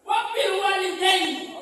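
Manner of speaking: high, loud, slow cry of the verse; emphatic recitation. Shown as a demonstration. Preacher drilling the congregation on the verse, high and loud. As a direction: proclaim the words to the whole hall.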